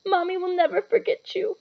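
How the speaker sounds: high, voice cracking with tears; a big man weeping over his baby; tearful. A narrator's reading. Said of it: "Hagrid sobbed".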